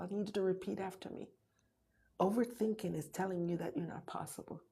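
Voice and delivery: usual speaking voice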